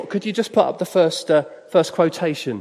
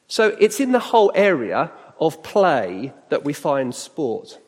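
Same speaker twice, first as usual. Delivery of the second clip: ordinary delivery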